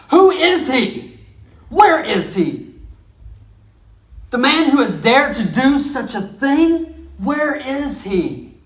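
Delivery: a king roaring for the culprit's name; demanding king. Acted. King demands to know who threatens his queen, outraged.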